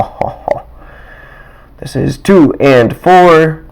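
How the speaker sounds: usual speaking voice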